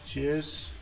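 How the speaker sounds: usual speaking voice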